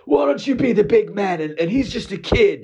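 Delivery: lecturing the customer to back down; preachy buddy. Retold or imitated. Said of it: "He goes".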